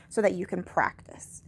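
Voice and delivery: whispered aside. Real, not acted. Teacher whispering a reminder to students. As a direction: breathe it out softly.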